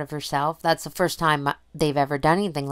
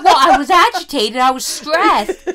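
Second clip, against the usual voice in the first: Ma Kettle voice; 9 semitones higher, 8 dB louder than usual. Retold or imitated. Mother explaining she was stressed, in Ma Kettle voice. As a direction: keep the farm-mom voice going. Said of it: "Ma Kettle voice".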